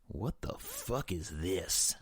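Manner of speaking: slow, tightly controlled anger; gritted out. A narrator's reading. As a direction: grit the words out slowly. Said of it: "I gritted out".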